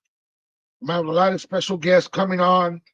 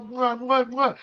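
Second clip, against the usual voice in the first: between kisses; 5 semitones higher, slower than usual. Real, not acted. Blowing kisses to viewers.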